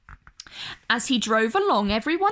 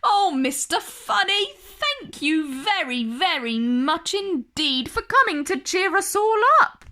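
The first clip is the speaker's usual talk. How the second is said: higher, amused zookeeper voice, lightly voiced; giggly zookeeper; 6 semitones higher than usual. A narrator's reading. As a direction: zookeeper thanks Mister Funny, giggling. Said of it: "who had started laughing as well".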